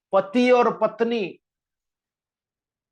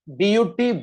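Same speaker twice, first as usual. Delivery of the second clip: ordinary talk, nothing marked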